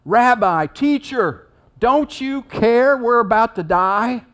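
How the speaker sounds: loud, high desperate plea to the teacher; crying out. Acted. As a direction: cry out in alarm, stretching the words. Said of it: "so they cry out".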